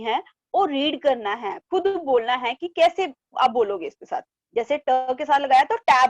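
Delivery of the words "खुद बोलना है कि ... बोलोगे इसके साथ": ordinary talk, nothing marked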